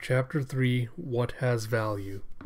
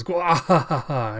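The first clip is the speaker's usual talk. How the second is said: slow, drawn-out mock evil laugh; villain cackle; 2 semitones higher, 5 dB louder, slower than usual. Cyborg head fleeing into the city, slow evil cackle. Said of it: "And he goes".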